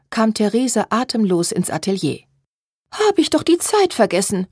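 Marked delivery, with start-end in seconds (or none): 2.9-4.5 high, plaintive sigh; sighing regret. A narrator's reading. Therese arrives late at the workroom, sighing. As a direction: lament your own forgetfulness. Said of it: "seufzte sie".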